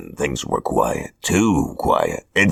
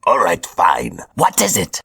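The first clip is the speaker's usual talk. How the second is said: higher, theatrical villain voice; Hobgoblin voice; 6 semitones higher than usual. Acted. Hobgoblin announces his chosen apprentices, smug villain voice. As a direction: play a smug supervillain handing out orders.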